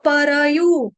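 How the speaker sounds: "परयु" very slowly, pa-ra-yu; drawn-out र. Shown as a demonstration. Teacher demonstrating to students, slow syllabic "परयु". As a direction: give र its own vowel in "परयु". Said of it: "र आधा नहीं है".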